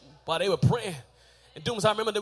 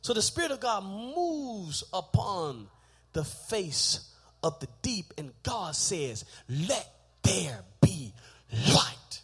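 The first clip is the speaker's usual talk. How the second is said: slow, low, solemn reading; intoned scripture; 3 semitones lower, slower than usual. Real, not acted. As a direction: draw out God's words slowly.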